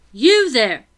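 loud sharp call across the yard; calling out loudly. Acted. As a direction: shout it out, high and loud. Woman calls from kitchen door.

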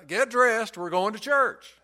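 urgent mock holler to the floor below; acted yell downstairs. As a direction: imitate a father ordering his son upstairs. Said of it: "the father yelled downstairs".

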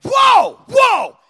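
very loud, high squawk; startled bird. Retold or imitated. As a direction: shriek like a squeezed parrot. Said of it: "Bo's like".

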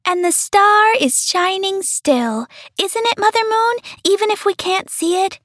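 put-on high, childlike star voices; small voices asking hopefully; little stars voice. A narrator's reading. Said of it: "the little stars always said".

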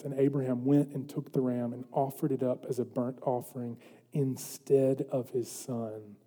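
low, very soft solemn narration; quiet, measured and grave; scripture reading. A narrator's reading. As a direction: read the Bible story in a hushed voice.

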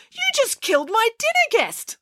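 high panicked Ali Baba voice; cried out. A narrator's reading. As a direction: shriek it in shock. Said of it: "cried Ali Baba".

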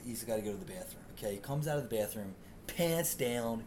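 ordinary delivery

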